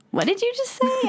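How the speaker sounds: incredulous coworker. Retold or imitated. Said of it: "my coworkers are like".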